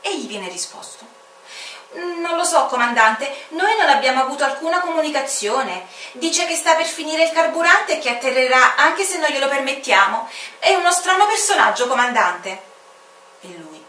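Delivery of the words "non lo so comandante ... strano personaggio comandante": higher, louder worried report; nervous officer reporting to his commander; puzzled young officer voice; anxious airport officer. Acted.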